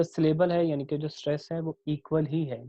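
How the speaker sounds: ordinary talk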